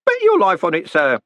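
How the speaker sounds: put-on high, cheerful old postman. A narrator's reading. Groat assuring Moist about the dogs, cheerful. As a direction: answer brightly, pitched well up. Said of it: "said Groat cheerfully".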